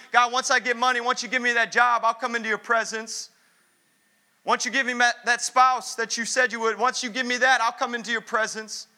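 loud, driving sermon cadence, calling the crowd up; full-force preaching. Acted. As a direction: hammer every line home to the congregation.

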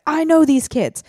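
mock indignant yell, pitched high; imitating screaming. Man defending kids from a neighbor, mock scream. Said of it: "he starts screaming at this guy".

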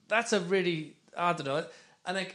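ordinary talk

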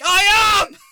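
very loud, high outburst; re-enacted enraged yell. Driver snapping back at the stranger, re-enacted rage scream. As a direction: scream back at full volume. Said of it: "I've never been that mad".

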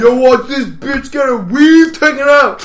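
slow loud high yell; hype fight filmer. Retold or imitated. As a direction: shout like filming a fight. Imitating fight-video filmer.

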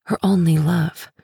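ordinary delivery

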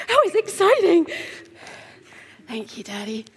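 a hint of a toddler talking; baby voice. Voicing the baby handed back.

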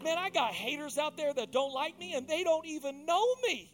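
fervent preaching swell; full-force preaching. Acted.

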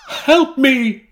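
high, loud gasping plea; gasped plea. Acted. Tortured artist begs William, high loud gasped plea. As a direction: beg for help in a high gasp. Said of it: "she gasped".

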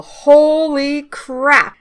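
slow, high, stretched-out amazement; amazed exclamation. Real, not acted. Fan reacting to the villain's face reveal, drawn-out amazement. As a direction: draw out each word in awe.

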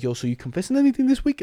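high, lightly put-on priest; mock priest voice. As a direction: ask like a nosy priest. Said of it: "they'd be like".

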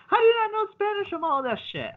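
high, mocking voice of nagging clients. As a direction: squeak it out like a whiny customer. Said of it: "They're like".

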